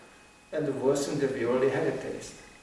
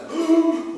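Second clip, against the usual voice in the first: sharp high gasp; loud gasp of shock; 8 dB louder than usual. Real, not acted. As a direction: gasp in disbelief. Shocked at today's world.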